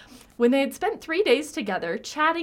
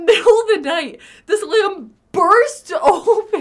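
laughing; 7 semitones higher, 9 dB louder than usual. Real, not acted. Laughing at grotesque image.

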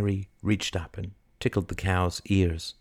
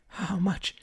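high, soft and breathless; gasped question; 11 semitones higher, 5 dB quieter than usual. Acted. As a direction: gasp it out, pitched up. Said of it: "gasped Mary".

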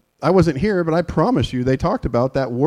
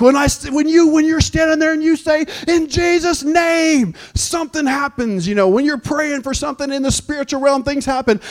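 fired-up preaching; 10 semitones higher than usual. Retold or imitated. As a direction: preach it to the rafters. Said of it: "And I was like".

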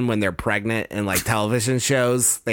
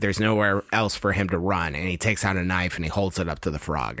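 normal talking voice

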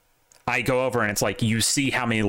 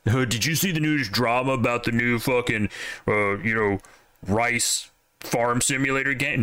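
mocking a clueless poster; 2 semitones higher than usual. Mocking a Twitter-bot guy to his podcast friends, lightly put-on voice. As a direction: imitate a gossip-hungry nobody asking a dumb question. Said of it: "being the guy who's like".

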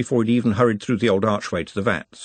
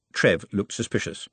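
usual speaking voice

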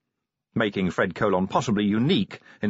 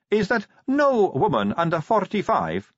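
higher, lecturing tone; Sally, lecturing; 8 semitones higher than usual. A narrator's reading. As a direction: lay down the rule. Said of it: "Sally managed".

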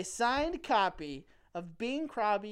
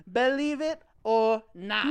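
slow, loud, drawn-out show title; game show announcer; 6 dB louder than usual. Acted. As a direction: announce it like a TV host.

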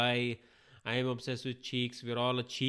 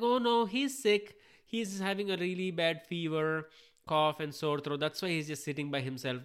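higher-pitched voice of a concerned aunt; worried mom; 5 semitones higher than usual. Retold or imitated. Aunt explaining why the kid is sitting alone, lightly acted worried mom. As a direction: start high and urgent, then settle as you explain.

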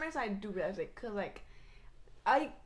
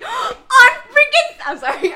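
laughing apology; 15 dB louder than usual. Real, not acted. After smacking her friend.

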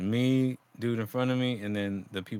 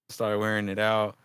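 normal talking voice

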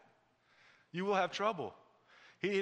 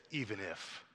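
low, quiet resolve; hushed, settled conviction; courageous faith; 7 semitones lower, 8 dB quieter than usual. Acted. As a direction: say it softly and firmly, pitched down. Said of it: "courageous faith says".

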